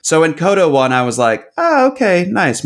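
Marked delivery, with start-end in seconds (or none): 1.6-2.6 high-pitched, mildly impressed reaction; pleasantly surprised. Retold or imitated. Reenacting reaction to Coda winning.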